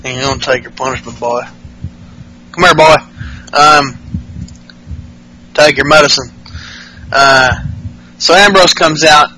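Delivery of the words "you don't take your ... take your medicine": lightly put-on Southern backwoods voice; mock hillbilly threat; backwoods drawl. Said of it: "That sounds very deliverance-y".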